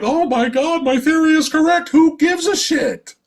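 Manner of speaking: high, hammed-up gushing with exaggerated wonder; mock excited gasp. Vlogger mocking a heroine's theory, gushing high. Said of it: "And then she's like".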